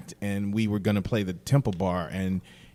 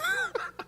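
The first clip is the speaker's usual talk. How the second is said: high laugh; 6 dB quieter than usual. Real, not acted. Punchline laugh.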